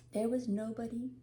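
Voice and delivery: ordinary delivery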